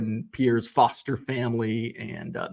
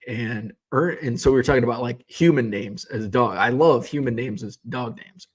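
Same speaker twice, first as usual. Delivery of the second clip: ordinary delivery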